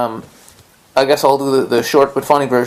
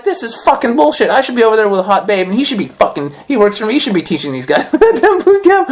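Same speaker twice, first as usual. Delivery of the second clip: much higher, exasperated complaining; retold outrage, ending in a laugh; indignant rant; 7 semitones higher than usual. Hearing his instructor teach her, indignant and high. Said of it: "I was angry".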